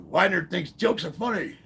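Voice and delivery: high, loud drill instructor bark; mock drill instructor yell. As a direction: yell it like an angry drill sergeant. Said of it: "He's like".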